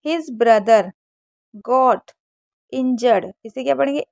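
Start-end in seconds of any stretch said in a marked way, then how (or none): none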